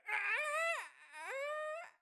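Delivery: shrill falling screams; high screams. Pretend. Acting racer falling.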